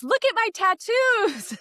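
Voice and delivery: put-on high, slow little-boy voice; imitating a little kid. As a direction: squeak it out like a proud toddler. Kid showing marker drawings.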